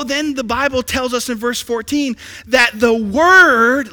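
ordinary talk, nothing marked

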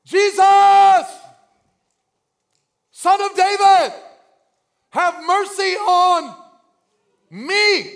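high, drawn-out shouted plea; full-voiced cry to be heard. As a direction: holler for mercy with long held words. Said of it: "he cried out".

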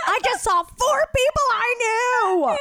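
high, slow, thrilled voice; excited. Retold or imitated. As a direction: gush it out, overjoyed. Retelling leaving in elation.

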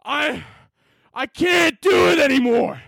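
high-pitched melodramatic dubbing scream; overacted anguished shout. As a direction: yell it with full hammed-up emotion. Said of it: "I would have been like".

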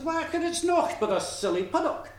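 high-pitched, scornful lassie; dismissive. A narrator's reading. Lassie tells her mother who is at the door, dismissive.

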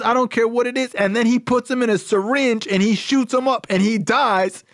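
very high-pitched, emphatic imitation; impassioned rant. Sponsor telling young caller that addicts die, impassioned rant. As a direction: push the voice way up, drive each phrase home. Sponsor rants in retold phone call.

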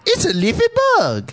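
very high comic movie-character squeal; Eddie Murphy. Acted. Host answers movie talk with actor impression, high squeal. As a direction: squeal it out like a goofy film character. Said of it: "That's my Eddie Murphy impression".

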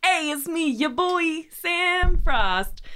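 hype intro. Acted.

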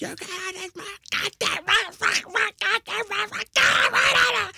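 drill instructor. Retold or imitated. Imitating brother's yelly military impression, drill instructor shout. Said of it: "his drill instructor voice is like".

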